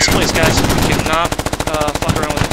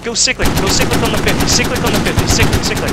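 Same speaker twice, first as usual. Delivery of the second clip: urgent higher-pitched command; shouting orders over battle; 5 semitones higher, 3 dB louder than usual. Real, not acted. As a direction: bark the fire order urgently. Ordering full-auto fire, urgent.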